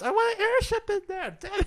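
high-pitched mock whine; whiny high complaint. Real, not acted. Fan moaning about the film having no airships, high whine.

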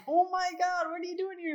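high-pitched astonished greeting; high, playful disbelief; acted surprise. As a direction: reenact being amazed to see someone, voice way up. Acts out surprise at friend's arrival.

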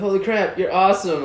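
mock starstruck praise; starstruck fan. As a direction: blurt it like a stunned fan.

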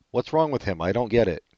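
usual speaking voice

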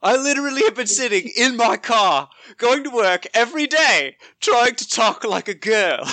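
man attempting a feminine voice; man doing a woman's voice. Shown as a demonstration. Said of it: "We can practice my talking like a girl".

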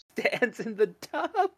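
high, slow, through laughter; laughing. Real, not acted. Host reading out a hilariously named stand, laughing. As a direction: squeeze the words out through a laugh.

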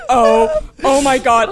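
loud, stunned "oh my God"; a retold jaw-drop moment; shocked gasp. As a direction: blurt it out in disbelief.

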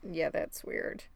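slow, hushed whisper of unease; whispered aside. Real, not acted. Cohost agrees the basement lock is creepy, whispered. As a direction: let it out under your breath.

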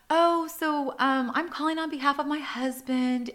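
high, loud, put-on phone voice; dramatic acting voice. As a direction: overplay the worried caller. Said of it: "I'm acting like".

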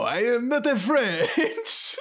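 high, stretched mock-French accent; bad French accent. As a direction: put on a laughing, hammed-up French accent. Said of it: "This is how the French people talk".

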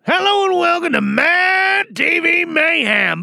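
big, drawn-out high announcer call; show announcer. Acted. Host opening the audio theater show, booming announcer. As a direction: bellow the show title slowly and high.

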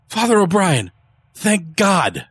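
high, urgent exclamation; desperate relief. A narrator's reading. Hunted man finding a priest he knows, relieved cry. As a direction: cry out as if rescued.

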